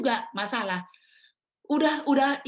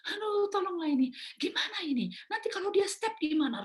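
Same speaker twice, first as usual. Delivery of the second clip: high-pitched frantic pleading; re-enacting a panicked, helpless mother; retold panic; 10 semitones higher than usual. Panicking mother pleads for help with feverish child, frantic. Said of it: "saya panik malam-malam, saya nangis".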